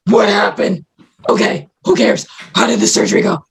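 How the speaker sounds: strained, croaky mock patient voice; wrecked post-anesthesia voice. Groggy patient asking about the surgery, strange croaky voice. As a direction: imitate a broken voice fresh out of anesthesia. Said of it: "This was literally my voice".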